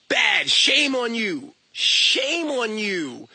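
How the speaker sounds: drawn-out, loud finger-wagging reproach; mock scolding. Shaming someone for watching humans have sex, mock scolding. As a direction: scold with long, heavy words.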